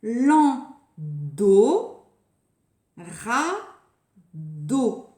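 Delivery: Landau and radeau in two separated beats; syllable by syllable. Shown as a demonstration. Teacher setting the next pair of words, syllable by syllable. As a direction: pause before the held DO in Landau and radeau.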